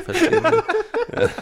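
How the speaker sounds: very high, slow laughing squeak; squeaky high voice. Pretend. Mocking high question.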